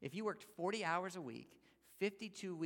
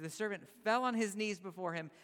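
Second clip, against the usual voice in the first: raised, earnest plea read from the story; mild pleading; 5 semitones higher, 7 dB louder than usual. A narrator's reading. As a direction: beg the master loudly, half-acted. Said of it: "he begged".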